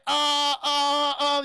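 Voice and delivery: loud, high, stretched-out hesitations; panicked stammer. Retold or imitated. Lucius reaching for the alarm button, panicked stammer.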